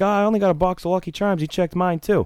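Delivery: high, quick, whining complaint; hammed-up high-voiced griper; mocking high whiny customer. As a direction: pitch it up high and gripe fast. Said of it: "He's like".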